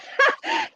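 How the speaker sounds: high squeal of a laugh; can't finish the word for laughing; giggle. Real, not acted. Laughing at the message.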